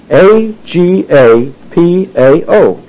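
slow, separate letters; spelling out. Shown as a demonstration. Preacher spelling the Greek word for love, slowly letter by letter. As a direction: spell it letter by letter for note-takers.

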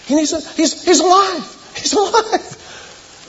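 high-pitched, astonished exclamation; acted amazement. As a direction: squeal it out as if witnessing a miracle. Acting out amazement at the raising.

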